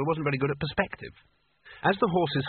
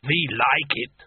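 slow, rumbling giant alien voice; 3 dB louder, slower than usual. A narrator's reading. Ice giant speaks.

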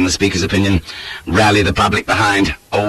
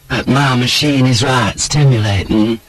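flirtatious lady in a higher voice; Tilda; 4 semitones higher than usual. A narrator's reading. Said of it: "drawls the fair Tilda".

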